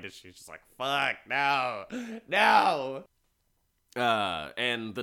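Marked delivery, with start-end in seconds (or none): 0.8-3.0 rising, louder anguished protest; despairing cry. Retold or imitated. Strapped-in wife protests to her husband, a loud desperate no. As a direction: cry out against being locked up.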